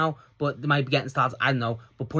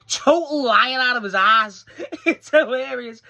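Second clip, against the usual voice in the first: laughing; 11 semitones higher, 6 dB louder, slower than usual. Real, not acted. Laughing at wrestler's fake apology.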